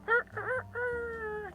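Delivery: high squeaky rooster crow; rooster crow imitation. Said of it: "a rooster going like".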